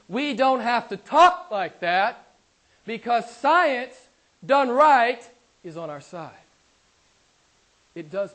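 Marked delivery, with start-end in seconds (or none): 0.1-2.3 "we don't have to talk like that" louder and slow, "talk" rising; emphatic. Real, not acted. Preacher stresses point to congregation. 2.8-5.2 louder, slow, punching each phrase; emphatic preaching. Real, not acted. Preacher building to emphatic climax.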